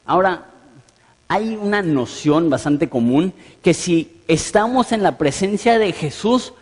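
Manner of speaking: ordinary talk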